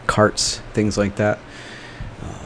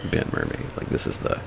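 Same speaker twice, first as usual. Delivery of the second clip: whispered aside; 9 dB quieter than usual. Real, not acted. Whispered aside about the original inn.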